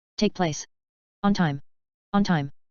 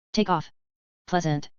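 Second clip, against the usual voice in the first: normal talking voice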